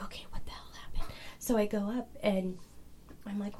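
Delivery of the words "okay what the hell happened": fast, whispered confusion; bewildered whisper. Retold or imitated. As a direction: hiss it under your breath, quick and baffled. Retold confusion after witness speaks.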